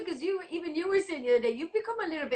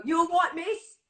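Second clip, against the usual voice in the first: higher-pitched London cab driver; English cabbie accent; 5 semitones higher, 4 dB louder, slower than usual. Acted. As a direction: imitate a chirpy English cabbie. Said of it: "I'll give you my best, uh, English".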